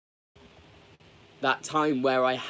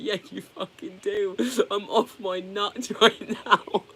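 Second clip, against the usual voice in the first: laughing panic; 8 semitones higher, 3 dB louder, slower than usual. Retold or imitated. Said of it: "And I was like".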